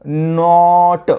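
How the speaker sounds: नॉट slowly, long open vowel; phonics blending. Shown as a demonstration. Teacher blending N, O, T into stretched नॉट. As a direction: draw out नॉट, then release ट. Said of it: "ओ आणि ट".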